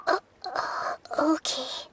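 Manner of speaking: whispered thanks, voice catching; tearful thanks. Acted. Nahida thanking Paimon for the advice, tearful whisper. Nahida relieved, near tears.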